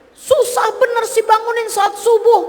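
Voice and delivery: high, mock scolding of a sleepy husband; nagging wife. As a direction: play the wife nagging him about dawn prayer. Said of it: "kamu kata-katain". (istri mengomeli suami yang tak bangun subuh, nada tinggi mengejek)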